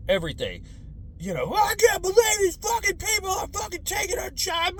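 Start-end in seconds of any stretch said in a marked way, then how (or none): 1.5-4.8 much higher, louder angry yell; ranting parent. Retold or imitated. Parents raging at the news, high angry shout.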